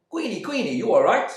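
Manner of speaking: high, loud anxious call. Acted. Neighbour calling for Queenie through the door, loud. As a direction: call past the husband to Queenie.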